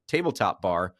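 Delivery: normal talking voice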